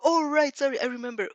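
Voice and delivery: usual speaking voice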